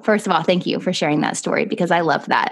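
ordinary talk, nothing marked

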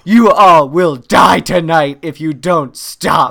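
loud, slow, deliberate menace; hammed-up slow, loud warning; mock threat. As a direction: threaten them one word at a time. Retold threat to noisy kids.

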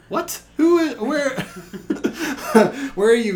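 high-pitched laughing disbelief; mock bafflement. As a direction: squeak out exaggerated confusion through a laugh. Mock bafflement at mangled surname.

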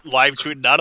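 ordinary talk, nothing marked

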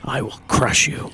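slow menacing superhero growl; Batman voice. Pretend. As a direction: draw it out like a brooding vigilante. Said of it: "I'm an excellent Batman".

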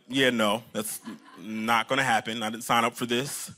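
low, quiet mock reluctance; deadpan refusal. Pastor voicing a reluctant disciple answering Jesus, low deadpan refusal. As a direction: mutter a low, unimpressed no. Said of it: "You're probably like".

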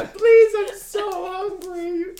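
high, drawn-out falsetto begging; mock pleading mom. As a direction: plead pitifully, totally hammed up. Mocking mom begging for food, money.

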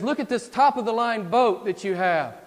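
loud, high sales pitch from the tempter; Satan tempting. Acted. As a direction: sell the temptation at full voice. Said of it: "Can't you hear Satan".

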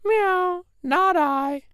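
high meowing cat refusing; cat's high meow and lazy no. Acted. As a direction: meow it, then refuse lazily. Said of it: "The cat said".

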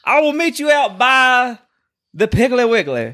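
hammed-up affronted Southern challenge; Southern accent. Host challenging a co-host to meet, overdone Southern accent. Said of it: "You sound like you're from Georgia".

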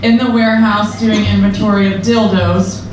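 ordinary delivery

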